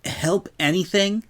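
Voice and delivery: a hint of a worn-out tomboy asking; Rainbow Dash voice. Rainbow asking the princess if it will help, lightly voiced.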